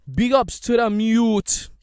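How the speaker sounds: slow, loud Jamaican patois shout-out; Jamaican toasting. Pretend. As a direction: bellow it like a Jamaican DJ on the mic. Repeats shout-out as dancehall toast.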